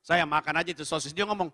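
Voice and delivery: usual speaking voice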